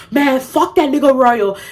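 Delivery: attempted African accent. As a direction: imitate him in an African accent. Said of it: "with the African accent".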